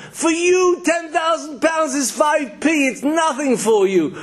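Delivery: high-pitched, outraged screaming rant; acted angry yelling. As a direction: yell it like an indignant, demanding beggar. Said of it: "yells at him and screams at him".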